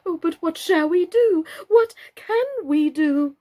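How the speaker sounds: high, loud anxious cry; panicked doll. A narrator's reading. Mr Plantagenet panicking over the dirty house, high and loud. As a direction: cry it out in helpless alarm.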